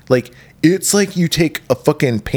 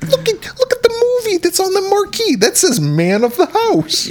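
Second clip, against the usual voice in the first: much higher, amazed disbelief; incredulous. Real, not acted. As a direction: point it out in shocked disbelief. Incredulous at the film's setting.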